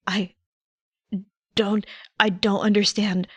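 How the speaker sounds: ordinary delivery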